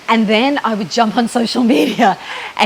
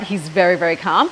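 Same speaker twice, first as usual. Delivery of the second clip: normal talking voice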